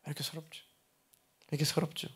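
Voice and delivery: softly imitating a weeping caller; small, hushed mock lament, without real sobs; retold tearful lament. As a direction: quote someone crying, gently and quietly. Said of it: "하면서 막 우시는 거예요".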